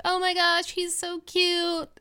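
put-on high, gushing girl voice over a cute pet; cooing girls. Girls at the pool table gush over the pig, high and cooing. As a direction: squeal it like a girl seeing a puppy. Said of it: "All the girls like".

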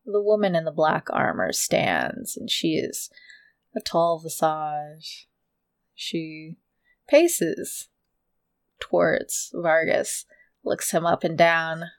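slow, low, hushed scene setting; low storyteller's voice, unhurried; game master narration. A narrator's reading.

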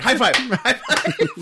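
high, loud and eager; excited high five. Retold or imitated. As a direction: cheer it out like a kid. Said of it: "Joey's like".

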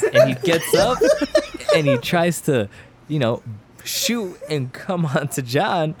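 laughing hard through the words, pitch jumping high; cracking up mid-story. Real, not acted. Telling friends the gross climax, breaking into laughter.

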